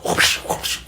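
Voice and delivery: whispered whoosh sound effect. Shown as a demonstration. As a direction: blow out a whoosh noise. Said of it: "that's the sound effect".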